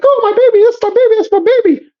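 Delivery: imitated high-pitched wail over a child; mock frantic mother. Mother coming out for her lost baby, mock frantic. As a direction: mimic a panicked mom claiming her baby, pitched very high. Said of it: "how she came out and was like".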